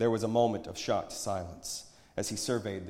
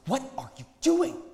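high, tense hiss at higher volume; hissed reproach; 8 dB louder than usual. A narrator's reading. Friend confronts the approaching prophet, hissing in reproach. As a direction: hiss it urgently, pitched up. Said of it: "his friend from the meeting place hissed".

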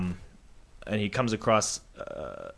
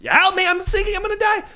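much higher, louder panicked cry; frantic yelling; 5 dB louder than usual. Acted. Man up to his shoulders in quicksand, screaming for help. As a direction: scream for your life as you sink. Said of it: "and he says".

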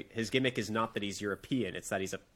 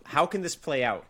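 ordinary delivery

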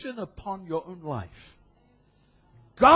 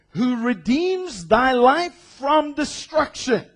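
slow, louder King James proclaiming; preached scripture; 3 semitones higher, 5 dB louder, slower than usual. Acted. Preacher reading verses to congregation, slow and ringing. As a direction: draw out each clause like a pronouncement.

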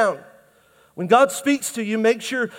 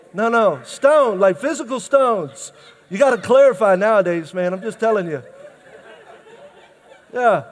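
usual speaking voice

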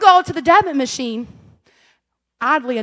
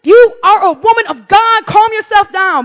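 high, loud, slow self-scolding; stern self-reminder; 7 semitones higher, 7 dB louder, slower than usual. Retold or imitated. Angry mom reminding herself, loud and stern. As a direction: scold yourself loudly, word by word. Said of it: "trying to remind myself".

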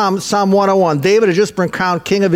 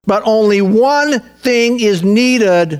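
slow, weighty scripture; 3 semitones higher, slower than usual. Shown as a demonstration. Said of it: "Now listen".

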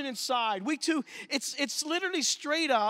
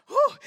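high, louder relieved exhale; relieved whistle of breath; high loud whew; 7 dB louder than usual. Real, not acted. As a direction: blow out the tension. Relief as atmosphere lightens.

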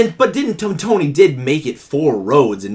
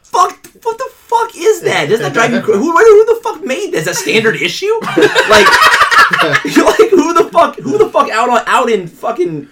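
high-pitched incredulous rant breaking into laughter; exasperated; 14 semitones higher, 3 dB louder than usual. Real, not acted. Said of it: "drives me fucking crazy".

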